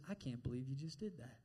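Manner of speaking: low, hushed shaming; the devil. Acted. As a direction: drop low and soft in mock disappointment. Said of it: "he fills you full of shame".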